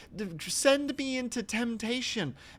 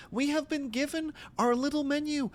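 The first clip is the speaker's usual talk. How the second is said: ordinary delivery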